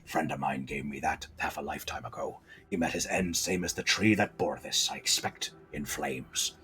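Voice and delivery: very low, soft, near-whispered pirate; old pirate hare. Acted. Old hare recalling a dead friend, low hushed pirate voice. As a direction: sink into a hushed old sailor's tale. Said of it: "this old hare is a pirate".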